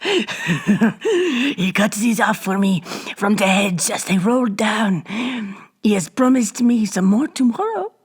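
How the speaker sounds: put-on slow, coarse old hag voice. A narrator's reading. Old hag taunts Bibot with her trophy, coarse. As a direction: gloat like a ghoulish crone.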